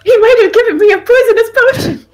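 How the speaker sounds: high-pitched mock outrage, laughter close by; mock alarm. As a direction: exclaim it loudly as if scandalized. Mock alarm at game choice.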